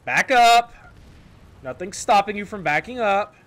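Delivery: high, loud shout to back up; loud annoyed order to reverse; frustrated yell. Real, not acted. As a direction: yell at the driver in the way. Yelling at blocking teammate.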